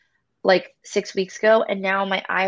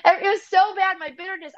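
tearful; 10 semitones higher, 3 dB louder than usual. Real, not acted. Testimony, voice breaking into tears.

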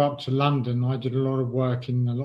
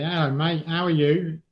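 higher, matey London greeting; London accent; 4 semitones higher than usual. Retold or imitated. Homeless man greeting a regular passer-by, London accent. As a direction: imitate a friendly London accent. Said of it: "in sort of his London accent".

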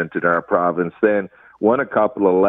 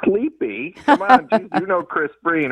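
mock indignant surprise; 11 semitones higher than usual. Real, not acted. Mock offence at sleepy jab.

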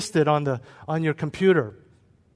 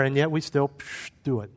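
ordinary delivery

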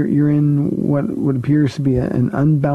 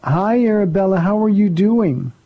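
sweet greeting; 6 semitones higher than usual. Real, not acted. Pastor greeting a little girl on his radio show, sweetly.

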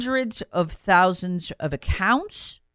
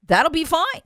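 mock obedient agents; 10 semitones higher than usual. Host mocking the FBI and Justice Department answering the president, in a high, servile, sing-song voice. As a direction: play the eager, spineless official agreeing. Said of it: "are like".

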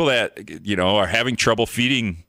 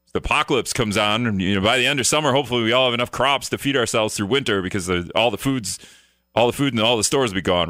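ordinary talk, nothing marked

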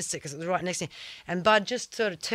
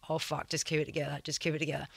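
softer, fast self-talk under his breath; muttering to self; 3 semitones lower, 9 dB quieter, faster than usual. Retold or imitated. Surfer steadying himself after the slap, muttering. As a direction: talk yourself into staying calm. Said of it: "And just sat there going".

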